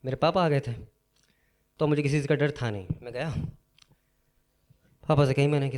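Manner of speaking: ordinary talk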